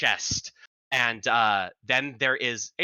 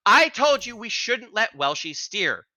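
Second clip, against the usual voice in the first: high-pitched druid protesting; raised, agitated high voice; yelling druid; 8 semitones higher, 4 dB louder than usual. Acted. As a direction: argue in a high, exasperated character voice. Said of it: "are currently yelling at what appears to be a bush".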